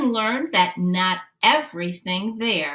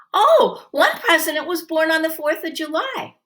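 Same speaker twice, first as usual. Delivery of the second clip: eager call-out; 8 semitones higher than usual. Acted. Students calling out answers.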